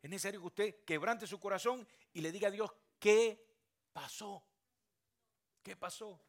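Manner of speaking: loud, high, impassioned sermon; fiery preaching. Acted. Pastor urging the congregation to pour out their hearts, shouting. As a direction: preach it out to the back row.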